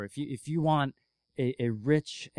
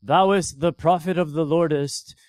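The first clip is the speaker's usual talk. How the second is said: loud, hammed-up King James preacher voice; mock pompous prophet; 3 semitones higher, 10 dB louder than usual. Mocking how a preachy prophet would answer the widow, in a loud pompous voice. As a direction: boom it out like an old-time pious prophet. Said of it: "He doesn't go".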